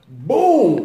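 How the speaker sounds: loud boom of a fall; mock thud. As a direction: punch it out like a body hitting the floor. Describing the fall again.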